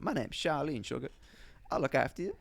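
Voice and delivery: gentle American auntie impression; imitated Southern American accent, soothing. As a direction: reassure in a put-on American accent. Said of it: "giving her an American accent".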